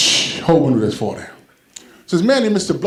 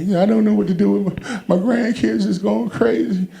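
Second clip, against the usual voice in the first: grandma; 7 semitones higher than usual. Retold or imitated. Grandma answers pastor.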